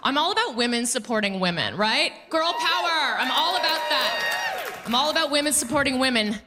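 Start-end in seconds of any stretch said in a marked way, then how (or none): 2.3-4.8 mock girl power. Mock cheer.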